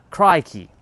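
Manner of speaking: high, loud mock-Aussie exclamation; playful Australian imitation. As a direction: call it out like an amateur Aussie impersonator. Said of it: "pretend I can even speak the Australian version".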